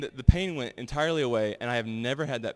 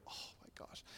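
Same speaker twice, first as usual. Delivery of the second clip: whispered dismay; 22 dB quieter than usual. Retold or imitated. Dismay at stomach pain.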